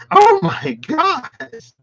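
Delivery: high, loud and slow astonished cry; shocked squeal. Real, not acted. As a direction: squeal it out in disbelief. Shocked at the cards.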